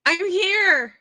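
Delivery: high, loud call across the room. Acted. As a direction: shout so he can find you. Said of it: "She calls to Kyle".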